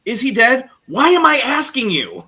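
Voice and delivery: high, loud question tumbling into exasperation; all in one line. Retold or imitated. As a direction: run both questions together, pitch jumping up. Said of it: "he says this all in one line".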